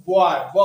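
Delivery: बॉय with a crisp, clearly isolated ब; stress on the b sound. Shown as a demonstration. Teacher isolating the first sound of boy. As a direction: hit the consonant ब cleanly. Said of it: "देखिए इसमें ब जो है".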